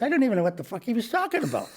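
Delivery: high, fast mock griping; baffled older passenger. Older passenger griping about the comedian, high quick complaint.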